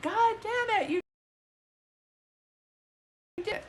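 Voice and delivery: a light mock of a mom insisting hard; mom's emphatic reply. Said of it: "she's like".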